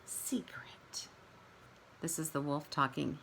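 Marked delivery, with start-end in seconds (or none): 0.0-1.1 whispered secret; hushed aside to the kids. Shown as a demonstration. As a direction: breathe the word like a secret. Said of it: "let you in on a little secret".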